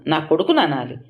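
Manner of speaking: plain reading voice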